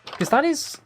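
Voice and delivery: surprised. Real, not acted. Reacting to surprising job answer.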